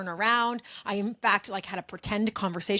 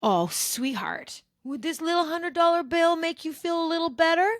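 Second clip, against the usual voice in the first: smug offer dripping with condescension; condescending heckler; 8 semitones higher than usual. Retold or imitated. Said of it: "waved it in front of me and said".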